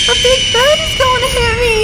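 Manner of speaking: fast, panicked and crying. Acted. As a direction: blurt it out in panic. Girl panicking while falling.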